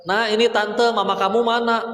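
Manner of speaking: a chirpy aunt asking for the mother; neighbour aunt on phone. Acted. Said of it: "Nelpon lagi tetangga".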